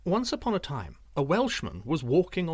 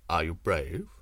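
low looming threat; 8 semitones lower than usual. A narrator's reading. Sorcerer voiced in story.

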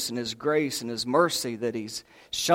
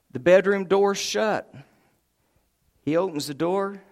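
normal talking voice

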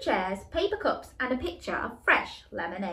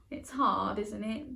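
ordinary delivery, nothing marked

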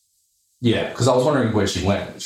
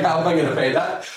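higher, laughing incredulity; laughing disbelief; 7 semitones higher than usual. Retold or imitated. Broke guy retelling the payment shock, laughing disbelief. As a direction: retell mild panic through a laugh.

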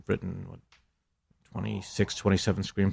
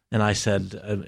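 ordinary talk

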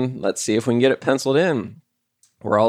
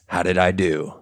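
ordinary talk, nothing marked

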